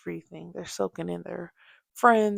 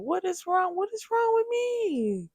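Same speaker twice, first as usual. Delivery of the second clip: much higher, pleading with yourself; exasperated self-scolding; 11 semitones higher than usual. Real, not acted. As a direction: throw up your hands at your own mistake. Mother scolding herself.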